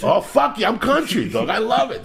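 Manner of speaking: high, loud hammed-up Southern twang; mock Southern accent; country accent. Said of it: "Got a little bit of an accent".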